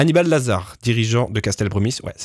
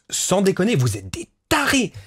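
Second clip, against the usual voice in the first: amazed; 7 semitones higher than usual. Real, not acted. Streamer amazed by chat's document.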